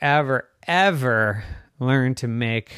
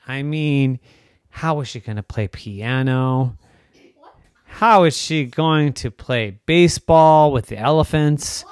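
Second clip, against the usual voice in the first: plain reading voice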